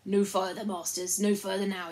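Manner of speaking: loud halt order from an unseen chief; storybook sentry challenge; invisible chief's challenge. A narrator's reading. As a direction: call out a halt, forcefully. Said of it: "a voice spoke out of the air".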